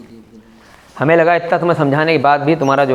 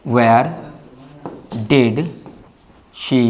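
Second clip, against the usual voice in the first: slow dictation; 4 semitones lower, 3 dB quieter, much slower than usual. Shown as a demonstration. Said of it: "यहाँ लिखते हैं".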